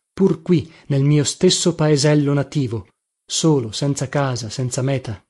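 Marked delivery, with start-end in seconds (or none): none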